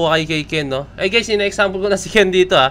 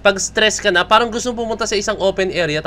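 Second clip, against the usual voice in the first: normal talking voice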